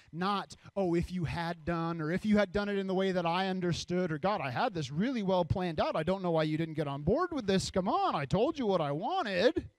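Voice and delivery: hammed-up frustrated pleading; exasperated complaining. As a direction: build to a petulant outburst. Acts out complaining to God.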